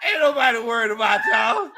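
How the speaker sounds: high, loud trash talk; mock indignant shout. Mock quarrel with rival fans.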